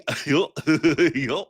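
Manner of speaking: goofy cartoon chuckle; silly cartoon dog laugh. Shown as a demonstration. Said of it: "I also do Goofy".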